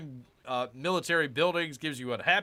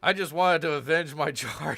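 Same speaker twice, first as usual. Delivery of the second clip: laughing while reading; 3 semitones higher, 8 dB louder than usual. Real, not acted. Reading funny game quote.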